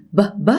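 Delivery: ب ب as short lip bursts; b sound. Shown as a demonstration. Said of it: "بخزن كل الهواء ورا شفايفي".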